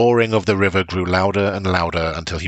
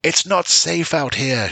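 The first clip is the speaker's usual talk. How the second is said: raised high shout; 9 semitones higher, slower than usual. A narrator's reading. Father shouting at his children in the storm, high. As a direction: yell over the storm at the kids. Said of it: "shouted Bran".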